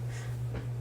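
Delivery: rough coughing fit; morning cough. Real, not acted. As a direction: cough it out, then apologise. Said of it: "I wish I wasn't dying, sorry".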